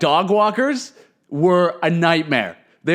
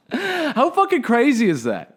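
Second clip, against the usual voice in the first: amazed disbelief; 9 semitones higher, slower than usual. Real, not acted. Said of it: "disbelief at own story".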